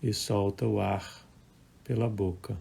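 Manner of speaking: calm, measured meditation voice with long pauses; guided meditation. Acted. Leads a live guided meditation. (voz calma de meditação guiada)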